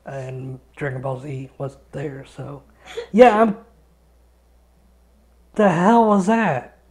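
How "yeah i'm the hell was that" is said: sudden loud startled yelp; real startled shout; startled outburst. Real, not acted. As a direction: jump at a scary noise. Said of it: "Was that somebody crying or laughing?".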